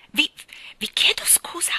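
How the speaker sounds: high, halting, frightened apology; trembling with fright. A narrator's reading. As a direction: stammer an apology in terror. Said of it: "tremando di spavento". (scuse spaventate, acute e balbettate)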